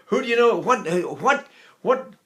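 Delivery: usual speaking voice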